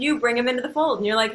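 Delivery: ordinary talk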